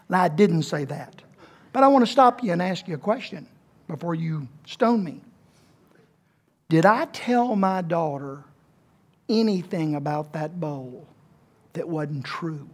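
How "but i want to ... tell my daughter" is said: ordinary talk, nothing marked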